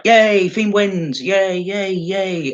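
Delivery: hammed-up fake celebration, dragging each yay; sarcastic cheer. Unimpressed fan mocking the Fiend's win with sarcastic cheers. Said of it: "It's like".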